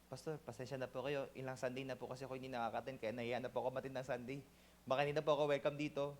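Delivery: soft, fast, embarrassed apology; ashamed excuse. Retold or imitated. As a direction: mumble the excuse quickly, ashamed. Ashamed member answers pastor. (nahihiyang mahinang paliwanag)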